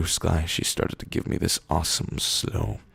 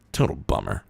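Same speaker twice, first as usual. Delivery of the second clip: usual speaking voice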